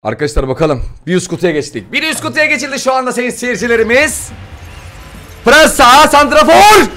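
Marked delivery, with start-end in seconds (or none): 1.9-4.3 high-pitched announcer proclamation; mock sports commentator. Mock commentator addressing viewers. 5.5-6.9 very high, loud commentator-like shouts; ecstatic screaming. Real, not acted. Screaming at Mbappe pack reveal.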